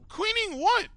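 loud, high-pitched disbelief; indignant, squawked-up protest; incredulous challenge. Real, not acted. Challenges the intruder's excuse.

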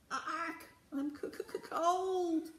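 high, squawky parrot voice with stuttering shivers; screeching parrot. A narrator's reading. Green parrot complains of the cold, screeching. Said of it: "screeched the green parrot".

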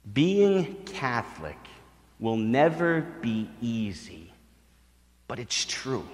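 slow, soft repetition; slow and quiet, for emphasis; solemn. Real, not acted. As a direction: let each word sink in. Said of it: "repeats uncle's words slowly".

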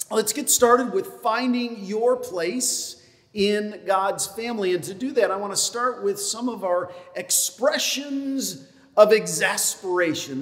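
ordinary delivery

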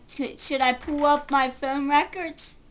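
normal talking voice

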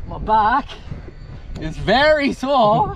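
loud high strained groan; words squeezed out by effort; straining. Real, not acted. Straining to lift fish.

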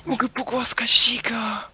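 whispering. Real, not acted. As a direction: whisper it eagerly. Whispered request.

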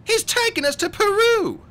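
put-on very high, loud child's shout; high-pitched desperate shout; shouting boy. A narrator's reading. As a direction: shout the warning, high and urgent. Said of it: "Klaus shouted".

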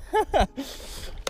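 excited high-pitched laugh; high giddy laugh. Real, not acted. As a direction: laugh it off, still buzzing. Laughing, shaking after the catch.

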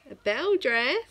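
light mock amazement; surprised. Real, not acted. Surprised at daughter's wish.

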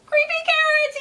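high, loud frightened shout; panicked child shouting; terrified shout. Acted. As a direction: shout it in terror. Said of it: "he shouted".

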